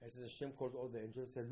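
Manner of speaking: usual speaking voice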